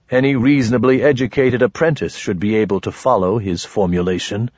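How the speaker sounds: usual speaking voice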